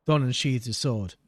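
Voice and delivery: ordinary delivery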